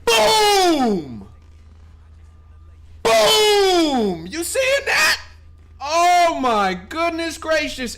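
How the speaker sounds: loud high Boom, cracking; triumphant shout. Real, not acted. Streamer yelling Boom at chat over his win, triumphant. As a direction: yell it to the whole chat. Celebrating profit.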